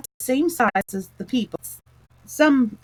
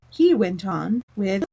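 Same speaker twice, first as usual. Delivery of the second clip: plain reading voice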